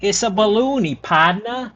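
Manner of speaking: high New Orleans Yat woman's voice; sing-song Yat clown cooing to a baby; Yat accent. Retold or imitated. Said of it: "strong Yat accent woman saying".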